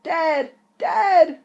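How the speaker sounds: high, loud panicked call; screaming for dad. Acted. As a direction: yell for dad in terror. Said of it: "he shouted".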